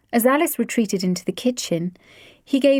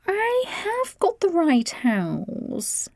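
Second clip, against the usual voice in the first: puzzled visitor; 10 semitones higher, much slower than usual. A narrator's reading. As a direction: check the address, bemused. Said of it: "she declared".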